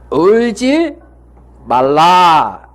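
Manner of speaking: slow, drawn-out 말라 after a pause; Jesus' words. Acted. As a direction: stretch the command 울지 말라 out slowly. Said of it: "뭐라고 말씀하셨습니까?".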